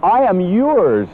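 "yours" drawn out and emphasized, slowly; slow down and land hard on "yours"; stress on yours. Shown as a demonstration. Preacher voicing the Lord's promise to Adam and Eve, slow stress on yours. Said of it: "with emphasis on yours".

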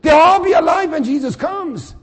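ordinary delivery

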